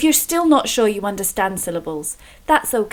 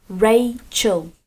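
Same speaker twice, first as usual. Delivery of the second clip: Rachel broken slowly into two beats, Ra-chel; split into syllables. Shown as a demonstration. Teacher showing children the syllables in Rachel, split into two beats. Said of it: "has two syllables. Can you hear them?".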